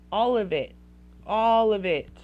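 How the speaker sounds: firm parental scolding; emphatic. Real, not acted. Emphatic repeated rebuke.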